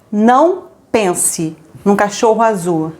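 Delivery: slow, deliberate "Não pense num cachorro azul"; slow demo command. Shown as a demonstration. Teacher demonstrating to listener, slow and deliberate. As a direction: drag it out so it sinks in. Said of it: "se eu falar pra você agora".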